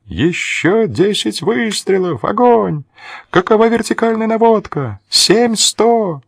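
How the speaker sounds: high, far-off shouts of a sleeping soldier; sleep talking. A narrator's reading. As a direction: call out fire orders in your sleep. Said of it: "кто-то кричит во сне".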